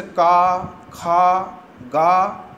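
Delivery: "का, खा, गा" each sounded firmly from the throat, held and separate; throat-sound demonstration. Shown as a demonstration. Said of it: "आपको लगेगा कि यह आवाज़ कंठ से". ("का, खा, गा" को कंठ से, अलग-अलग और खींचकर बोलें)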